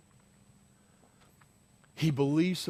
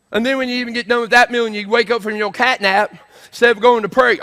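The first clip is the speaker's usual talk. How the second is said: full-force preaching; 10 semitones higher, 13 dB louder than usual. Real, not acted. Preaches at the congregation.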